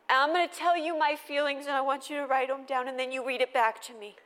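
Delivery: put-on a small emotional kid asking for help; child's voice. Mom imitating her son asking her to flatten his feelings.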